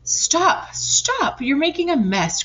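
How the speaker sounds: high alarmed cry; cried out. A narrator's reading. First hen crying out at the pigs' messy painting, high. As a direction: stop them in a panic. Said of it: "cried the first hen".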